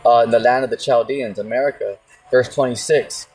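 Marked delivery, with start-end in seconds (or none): none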